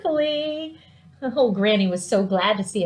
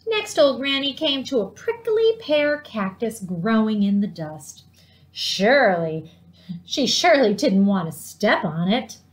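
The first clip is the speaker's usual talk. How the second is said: plain reading voice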